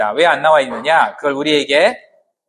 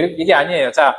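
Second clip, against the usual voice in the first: usual speaking voice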